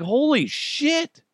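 drawn-out, high shocked "Holy shit"; stunned. Retold or imitated. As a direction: let the shock stretch out slowly. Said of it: "I was like".

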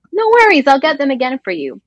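cheerful reassurance. Retold or imitated. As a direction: brush it off eagerly. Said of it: "he's like".

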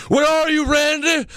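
very high-pitched mock yell; high, taunting imitation of a yelling wrestler; Bray. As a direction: call out in a shrieky falsetto, hammed-up. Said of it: "and he yells".

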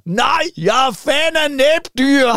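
high, slow, stretched-out shouted heckle; retold shout. Big brother shouting from the audience, high and drawn out. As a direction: call it out drawn-out from the crowd. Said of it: "råber min storebror".

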